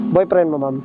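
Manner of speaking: usual speaking voice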